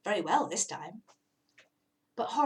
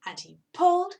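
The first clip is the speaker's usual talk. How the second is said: higher, louder straining pull; 8 semitones higher, 7 dB louder than usual. A narrator's reading. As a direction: heave harder this time. Tugging build-up.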